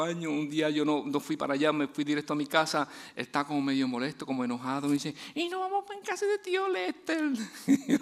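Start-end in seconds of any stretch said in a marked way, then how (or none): 5.3-7.3 put-on much higher, whiny little boy voice; small child complaining. Father imitating his little son whining about skipping the uncle's house. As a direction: sound like a sulky four-year-old.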